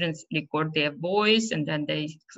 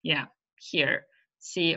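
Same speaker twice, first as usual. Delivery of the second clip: usual speaking voice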